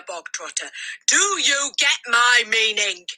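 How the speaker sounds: a tyrant bellowing orders from the platform; furious shouting. A narrator's reading. Headmistress forcing the boy to eat the cake, furious shouting. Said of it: "she shouted".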